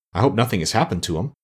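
usual speaking voice

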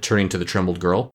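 plain reading voice

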